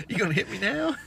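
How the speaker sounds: mock challenge. Son taunting dad from behind a child, mock challenge.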